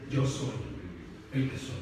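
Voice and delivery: voz baja y grave, con pausa; pronúncialo bajo y pausado; cita solemne. Acted. Solemn quote of God to Moses. (solemne y contenido)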